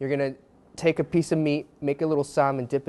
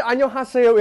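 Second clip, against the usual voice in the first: put-on Korean greeting in a higher, louder Australian accent; hammed-up Australian accent on 안녕하세yo; 9 semitones higher, 9 dB louder than usual. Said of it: "in an Australian accent".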